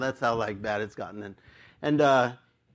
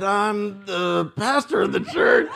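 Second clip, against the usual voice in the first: slow, higher, strained admission; reluctant confession; 4 semitones higher, 3 dB louder, slower than usual. Retold or imitated. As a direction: drag the embarrassing admission out. Reluctant confession of his job.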